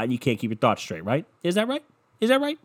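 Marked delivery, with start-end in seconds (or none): 1.4-2.6 mocking high question. Mocking himself.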